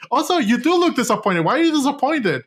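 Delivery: high, fast, indignant scolding; hammed-up high rant at a movie heroine; mock rant. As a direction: tell the character off, half in jest. Ranting at the character on screen.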